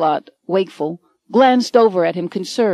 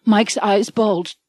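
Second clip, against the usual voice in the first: ordinary delivery, nothing marked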